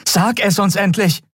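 high-pitched urgent demand; desperate. A narrator's reading. Endriel presses Kai to talk, desperate. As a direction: beg and order at once. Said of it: "Sie hörte die Verzweiflung ihrer eigenen Stimme".

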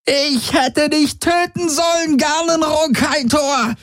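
very high slow screeched curse; shrieking. Acted. Prisoner shrieks at her captor, very high and slow. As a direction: screech your hatred at him. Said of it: "kreischte sie".

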